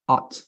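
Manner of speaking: "at" said as "aht", without any ow glide; American ought. Shown as a demonstration. Teacher showing "like this" to the student, American ought. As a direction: model "at" with open ah and final T. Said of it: "like this".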